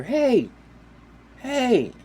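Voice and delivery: high, loud mock call from afar; imitated shout at strangers. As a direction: holler it high, like an angry landowner. Landowner calls out to boaters.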